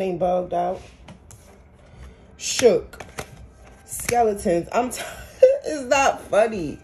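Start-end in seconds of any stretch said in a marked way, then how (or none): 4.7-6.8 breaking into a high laugh mid-word; laughing despite yourself; real laughing. Real, not acted. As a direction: try to say it through laughter, slowing down. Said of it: "I'm laughing 'cause it's like".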